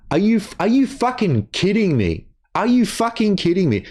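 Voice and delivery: high, incredulous and exasperated; can't believe what he's hearing; real frustration boiling over; exasperated outburst. Real, not acted. Angry outburst at accusation.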